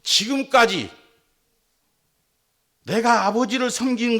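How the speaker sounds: high, loud, resentful outburst; angry elder son. Retold or imitated. Elder son angrily protesting to his father, loud and high. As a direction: hurl the complaint at your father. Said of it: "화를 내며 말하기를".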